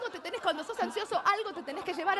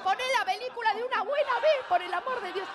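higher-pitched exasperated demand; impatient outburst; 6 semitones higher than usual. Acted. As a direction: demand it like your patience ran out. Acted impatient outburst at cinema notices. (estallido de impaciencia, tono agudo)

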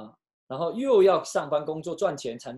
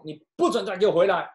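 angry father; 4 semitones higher, 6 dB louder than usual. Retold or imitated. Father throws daughter out angrily.

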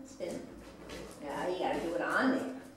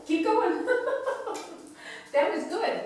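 laughing; 11 semitones higher than usual. Real, not acted. Laughing while urging the dog.

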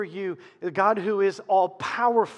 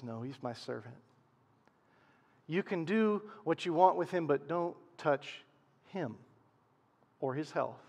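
quiet, understated authority, softer; God; 3 semitones lower, 6 dB quieter than usual. Acted. God setting Satan limits on Job, quiet understated command. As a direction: answer softly and firmly, lightly in role. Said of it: "God says".

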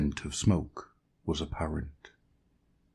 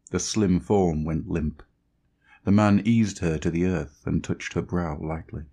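ordinary delivery

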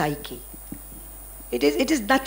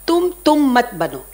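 voicing the Guru, a bit louder and firmer; Guru's teaching; 3 semitones higher, 7 dB louder than usual. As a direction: quote the teacher's maxim with weight. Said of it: "he used to say one thing".

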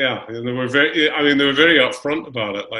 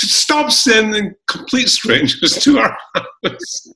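high, slow, exasperated plea; exasperated siblings; 11 semitones higher, 3 dB louder, much slower than usual. Retold or imitated. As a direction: plead with your sister to stop. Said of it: "would call and say".

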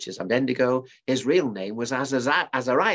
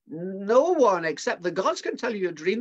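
higher, pleading protest; protesting astrologers; 8 semitones higher than usual. Acted. Astrologers protesting to the king, higher pleading voice. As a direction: insist it is impossible. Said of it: "the astrologers protested".

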